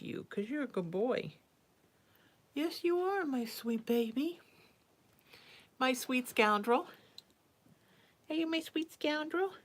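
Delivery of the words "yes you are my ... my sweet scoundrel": high sing-song cooing. Real, not acted. Mom praising her sweet scoundrel cat, high cooing. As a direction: dote on him, pitch way up.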